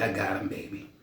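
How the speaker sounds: soft, low promise to wife; hushed confident reply to wife; reassuring husband. Retold or imitated. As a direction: quietly tell the wife you've got it. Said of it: "I said".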